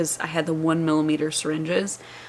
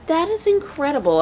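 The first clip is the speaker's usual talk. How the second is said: amazed; 9 semitones higher than usual. Real, not acted. Mom showing viewers her postpartum belly, awestruck. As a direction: let the wonder stretch the words out. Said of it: "I'm amazed".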